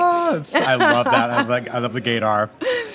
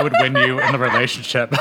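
laughing; 2 semitones higher than usual. Real, not acted. Laughing joke about divorce.

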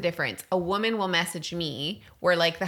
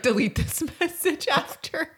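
much higher, laughing, hushed voice; 1 secret husband voice; 10 semitones higher than usual. Retold or imitated. Wife mocking husbands' secret texts, in a high giggling squeak. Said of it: "They're like".

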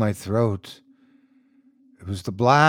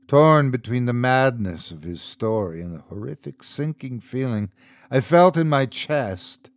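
ordinary delivery, nothing marked

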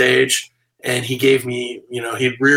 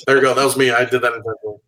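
ordinary delivery

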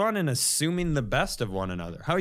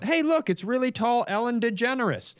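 high, far stranger calling out; high voice of a passerby; 9 semitones higher than usual. Retold or imitated. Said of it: "somebody walked up to me and went".

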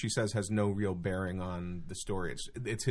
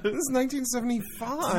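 put-on whiny teen. Mock teen voice, laughing.

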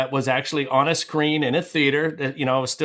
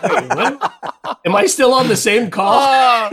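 loud, high-pitched incredulous outburst; baffled, raising the voice as if checking the line; mock disbelief; 8 semitones higher, 7 dB louder, slower than usual. Composer recoiling from the phone at the director's warning, loudly incredulous.

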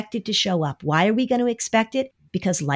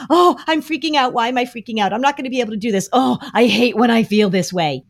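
high, louder panicked fretting; panicky; 5 semitones higher, 4 dB louder, faster than usual. Shown as a demonstration. Parent showing the wrong way, panicky. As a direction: spiral into panic. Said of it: "Instead of saying".

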